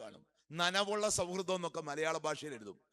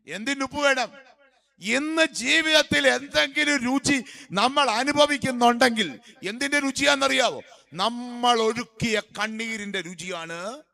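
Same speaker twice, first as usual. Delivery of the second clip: loud, high-pitched sermon cry; raised, ringing preacher's voice; full-force preaching; 7 semitones higher, 13 dB louder than usual. Real, not acted. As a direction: preach it out to the whole congregation.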